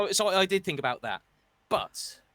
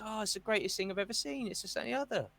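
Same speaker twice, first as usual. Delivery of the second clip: higher, softer gushing mimic; fair-weather fan suddenly gushing; mocking gushing fan; 6 semitones higher, 5 dB quieter, faster than usual. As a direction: send up a sudden convert. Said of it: "now it's".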